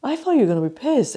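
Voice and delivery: quick mock bewilderment; puzzled inner thought. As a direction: race through it, mimicking someone privately baffled. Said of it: "she was going in her head like".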